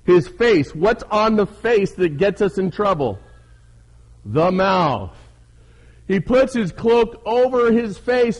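high, loud, emphatic preaching. Real, not acted. Preacher explaining Elijah's covered mouth to leaders, fervent preaching.

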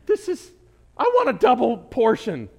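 high, loud, disbelieving outburst; amazed exclamation. Retold or imitated. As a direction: blurt it out in happy disbelief. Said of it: "I'm like".

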